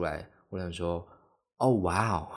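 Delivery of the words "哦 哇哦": louder wow in a put-on voice; a staged gasp of surprise at the rank; mock surprise. 看到排名惊讶. (夸张地惊讶“哇哦”)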